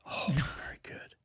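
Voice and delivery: hushed little aside; whisper. Real, not acted. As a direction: whisper it, impressed.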